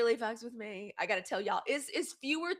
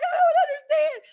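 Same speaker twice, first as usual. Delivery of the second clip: put-on upset child; 7 dB louder than usual. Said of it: "And I was like".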